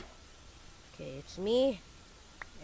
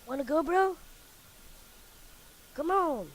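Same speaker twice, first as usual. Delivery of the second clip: taunting; 9 semitones higher, 8 dB louder than usual. Real, not acted. Taunting opponent in game.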